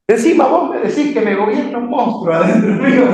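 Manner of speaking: high-pitched, amused mock protest; mock indignant reaction. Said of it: "este muchacho reaccionó así".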